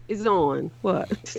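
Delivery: usual speaking voice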